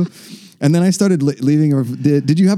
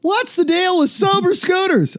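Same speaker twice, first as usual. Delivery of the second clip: much higher, slow standup whine; Seinfeld impression; slower than usual. Doing Seinfeld to tease Mark Ellis, high and slow. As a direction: ask it like a Seinfeld bit. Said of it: "went Seinfeld on Mark Ellis".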